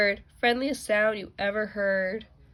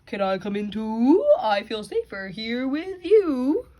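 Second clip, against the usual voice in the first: lightly voiced gentle cow; 2 semitones higher, 4 dB louder than usual. A narrator's reading. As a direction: speak like a calm cow. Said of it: "said the cow".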